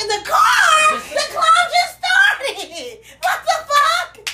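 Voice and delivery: very high, loud, slow laughing shout; outraged shout. Retold or imitated. Outraged she wants to leave.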